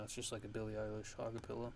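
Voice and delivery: usual speaking voice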